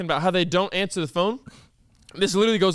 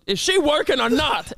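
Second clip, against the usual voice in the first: higher, impatient imitation; angry old man; 8 semitones higher than usual. Old customer pressing the staff, mock angry impatience. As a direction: demand it testily, as the old man. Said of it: "Why is he so angry?".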